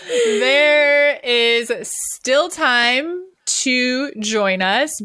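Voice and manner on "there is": louder, laughing through words. Real, not acted. Laughing at Bali joke.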